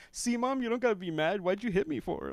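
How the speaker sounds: put-on high kid voice; little boy. Little boy complaining to his mom in a high voice. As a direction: whine it at mom. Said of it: "he was just like".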